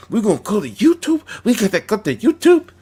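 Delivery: high, loud, rapid-fire mimicry of their threats; mock threat. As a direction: squeal their threat mockingly, fast and loud. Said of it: "they would threaten".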